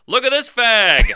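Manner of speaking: high, loud jeer from the crowd; mock heckler shout. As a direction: call it out like a heckler. Heckler calling out.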